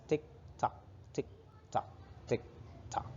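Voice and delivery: soft whispered tick tock; clock ticking. Shown as a demonstration. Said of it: "A clock says".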